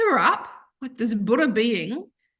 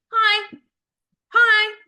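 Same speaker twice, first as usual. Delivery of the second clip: high, louder little dog pleading for notice; Maisie's voice; 12 semitones higher, 8 dB louder than usual. Retold or imitated. Other dog Maisie begging for attention, high and louder. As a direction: squeak hi like the forgotten dog.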